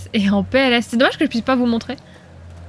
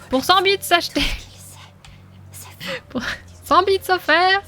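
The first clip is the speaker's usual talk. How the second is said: normal talking voice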